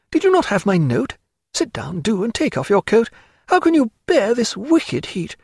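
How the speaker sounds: high, animated society woman; effusive Diana, delighted. Acted. Diana fussing over her visitor Stephen, high and effusive. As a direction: chatter eagerly in a high feminine voice.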